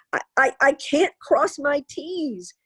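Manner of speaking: high, slow, halting mock wail; retold tearful child. Crying boy tells his mother about homework, imitated upset. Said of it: "laying in the top of his bunk bed crying".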